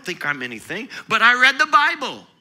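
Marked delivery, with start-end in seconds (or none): 1.1-2.3 loud, high, fervent sermon; full-force preaching. Acted. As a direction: preach it to the rafters.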